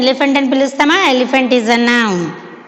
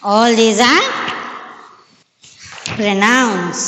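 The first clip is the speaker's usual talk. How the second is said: one word at a time, long pauses; measured list for pupils writing along; dictation; much slower than usual. Real, not acted.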